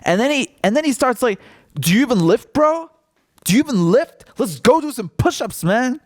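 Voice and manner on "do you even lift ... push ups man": lively, high-pitched taunting; mock macho challenge. Biden mockingly challenging a voter to push-ups, hammed-up bravado. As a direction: goad him in a mocking, raised voice.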